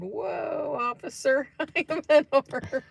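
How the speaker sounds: mock surrender. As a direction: pretend to surrender to a cop, laughing. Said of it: "I said".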